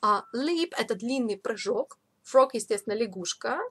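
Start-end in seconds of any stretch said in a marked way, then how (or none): none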